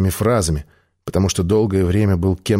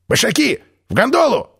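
high shouted summons; calling out; 13 semitones higher than usual. Acted. Чак зовёт всех в гондолу. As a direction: call everyone aboard. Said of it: "позвал Чак".

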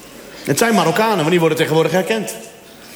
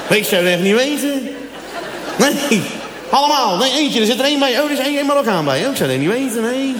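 higher, puzzled Dutch voice; mock clueless Dutchman; 5 semitones higher than usual. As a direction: play a confused Dutch local squinting at faces.

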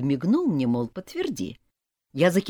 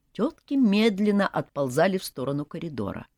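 usual speaking voice

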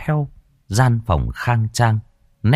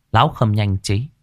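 usual speaking voice

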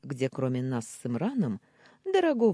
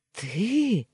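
higher, louder shocked question; stunned babble; 6 semitones higher, 5 dB louder than usual. A narrator's reading. Uncle sees his nephew alive, stunned. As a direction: blurt it in startled disbelief. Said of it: "залепетал он".

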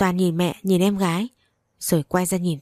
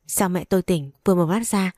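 ordinary delivery, nothing marked

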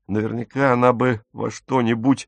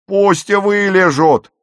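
loud, high, drawn-out yell; full-voiced screeched order; bellowed order; 10 semitones higher, 8 dB louder than usual. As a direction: scream the command out slowly at a crowd. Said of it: "орала она".

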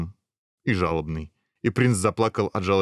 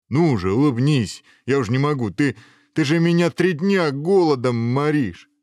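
louder, higher complaint from a starving ogre; exasperated pleading; 6 semitones higher, 5 dB louder than usual. Acted. Starving ogre urging the prince to smile, exasperated pleading. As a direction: plead at the end of your patience.

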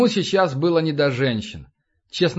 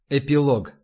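ordinary delivery, nothing marked

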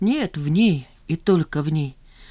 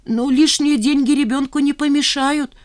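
higher, louder motherly coaxing; fussing mother; 9 semitones higher, 4 dB louder than usual. Acted. Mother persuading Nina to take the money, coaxing. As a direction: talk her into it gently.

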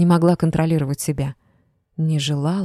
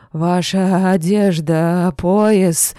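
slow, louder, frightened mumbling excuse; fearful excuse; 2 semitones higher, 5 dB louder, much slower than usual. A narrator's reading. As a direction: stammer out an excuse. Drunk making excuses.

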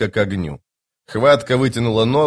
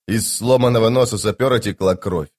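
ordinary delivery

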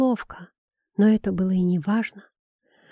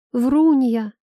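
high, loud yell at mother; 4 semitones higher, 5 dB louder than usual. A narrator's reading. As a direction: yell it out in anger. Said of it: "заорал Исаак".

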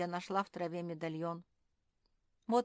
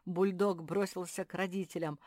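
usual speaking voice

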